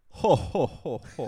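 a jolly mock Santa laugh. Shown as a demonstration. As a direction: ho ho like Santa, fading at the end. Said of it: "how I laugh at fun fat jokes".

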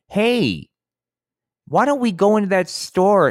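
high-pitched, singing-out mom impression. Mom suggesting a store to her son, in mock mom voice. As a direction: pitch it way up as a chirpy mother.

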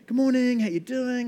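high, bright greeting voice; cheery customer. Retold or imitated. Lovely customer greeting the barista, high and cheery. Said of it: "they are super lovely".

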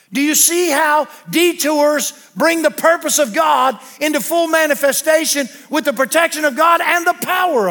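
loud, high, emphatic pulpit delivery; full-force preaching. Real, not acted. Preacher proclaims God's healing power through Paul, full-force preaching. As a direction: drive each phrase home to the congregation, slowly.

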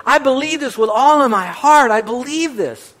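high, loud, full-hearted cry; heartfelt loud declaration. Retold or imitated. Widower proclaiming faith to the pastor, loud and fervent. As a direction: proclaim it with all your heart.

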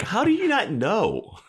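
hammed-up incredulous squeak, slowly; mock disbelief.

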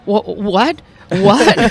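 startled double take, rising; surprised. Retold or imitated. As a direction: stammer out a confused what. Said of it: "he was like".

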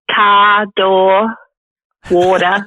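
"car", "door", "water" with no R at the end; Australian dropped R. Shown as a demonstration. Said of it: "no R at the end or in the middle".

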